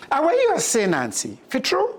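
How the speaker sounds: a hint of wary surprise in patois; doubting Mongoose. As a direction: ask it half-believing. Mongoose questions Anansi.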